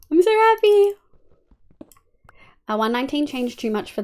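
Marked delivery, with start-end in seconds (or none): 0.1-0.9 high, loud squeal of joy; voicing the mob grinder, thrilled; squeaky happy mob. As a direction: squeal it like a delighted little mob.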